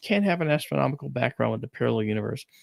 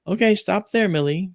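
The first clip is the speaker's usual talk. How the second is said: lightly voiced grandmother halting her daughter; grandmother's voice; 7 semitones higher than usual. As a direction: snap out stop there Millie. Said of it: "She's thumbing through 'em".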